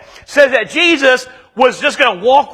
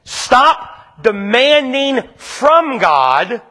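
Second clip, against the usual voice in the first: slow emphatic charge; 2 semitones higher, much slower than usual. Acted. Preacher urging his congregation, slow and emphatic. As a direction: stop demanding from God, each word slow and deliberate. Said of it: "preacher slows key line".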